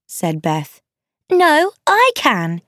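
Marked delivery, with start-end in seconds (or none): 1.3-2.6 high, drawn-out insistent outcry; mild shout. A narrator's reading. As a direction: call it out high and slow, staking a claim. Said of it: "shouted Amy".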